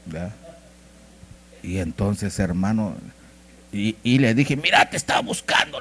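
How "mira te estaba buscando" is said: high-pitched retold phone hello; imitated eager caller, pitched up; eager greeting. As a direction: call out as if finally reaching someone. Retold call to evasive borrower.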